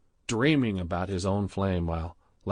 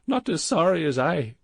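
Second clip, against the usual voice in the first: put-on high, mournful Indian accent; wounded and self-pitying; lamenting; 8 semitones higher, 4 dB louder than usual. A narrator's reading. Gupta heartbroken at Badrul, mournful Indian accent. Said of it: "Gupta lamented".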